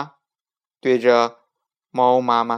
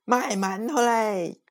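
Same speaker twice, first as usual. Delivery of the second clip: higher hawker call; boy mimicking vendor; 10 semitones higher, slower than usual. A narrator's reading. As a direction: copy the vendor's cry. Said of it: "又大叫一声".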